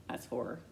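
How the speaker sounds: sharp gasp of horror, then the words; horrified gasp. Shown as a demonstration. Narrator acting out mom's horrified face with a gasp. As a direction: act out sudden horror with a gasp. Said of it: "an expression of utter horror".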